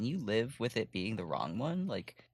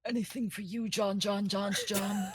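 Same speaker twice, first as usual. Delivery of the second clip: devoted henchman; 10 semitones higher than usual. Acted. Player voices character devotedly.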